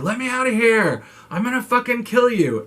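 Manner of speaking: high, furious yell to be let out; screaming threat. Retold or imitated. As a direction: scream it, trapped and raging. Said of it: "And he's screaming".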